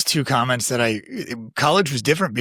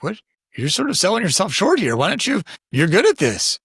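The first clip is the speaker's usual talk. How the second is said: encouraging teacher; 7 semitones higher than usual. Retold or imitated. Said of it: "It was like".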